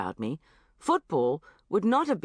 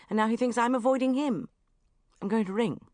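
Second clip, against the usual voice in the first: ordinary delivery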